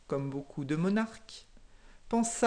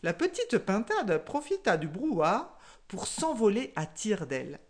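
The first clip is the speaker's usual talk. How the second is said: ordinary delivery, nothing marked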